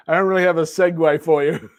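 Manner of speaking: amused, through chuckles; real giggly admission; laughing. Real, not acted. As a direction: talk while cracking up. Cracks up over missing segue.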